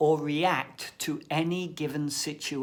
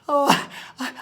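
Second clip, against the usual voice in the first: ordinary talk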